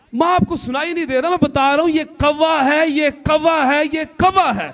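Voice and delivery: impatient son. Retold or imitated. Son loses patience shouting at his old mother. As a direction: snap it out, fed up.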